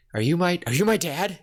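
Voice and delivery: high, loud disbelieving question; acted shock. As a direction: blurt it as a stunned son. Acting boy's shocked question.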